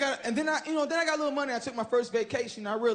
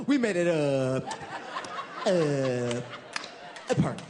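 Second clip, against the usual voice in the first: imitating the shy guy; 6 semitones lower, slower than usual. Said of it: "He's like".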